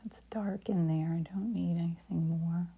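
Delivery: soft whisper to herself. Real, not acted. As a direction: whisper as if painting alone. Whispered painting narration.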